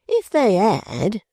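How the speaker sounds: high, slow questioning repeat; inquiring tone. Acted. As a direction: echo his words as a probe. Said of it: "repeated Pancks in an inquiring tone".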